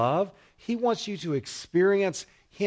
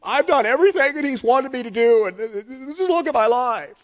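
whining, pitched up and louder, self-pity spilling over; self-pitying complaint; 4 semitones higher, 3 dB louder than usual. Acted. Preacher voicing a pouter who feels wronged, whining complaint. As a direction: play the wronged believer pleading his record.

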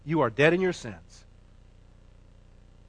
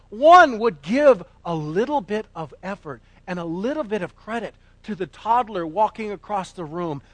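ordinary talk, nothing marked

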